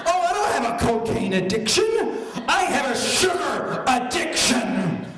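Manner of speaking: high, hammed-up protest; indignant shouting. Sugar addict defends herself to Lori, indignant. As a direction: yell the denial, stretching out the end. Indignant denial to accusing friends.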